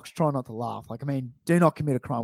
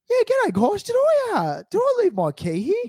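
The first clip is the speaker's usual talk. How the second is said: high mock voice. Mimics friend asking for key.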